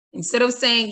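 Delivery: usual speaking voice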